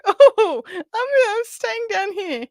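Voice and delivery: high, slow mock crying whimper. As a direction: whine it like a stranded kid, hammed-up. Retelling legs giving out.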